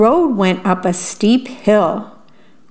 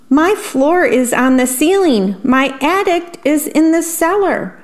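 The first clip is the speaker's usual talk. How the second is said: higher, wailing worm; distressed cry; 7 semitones higher than usual. A narrator's reading. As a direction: cry out at the upside-down house. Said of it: "cried the worm".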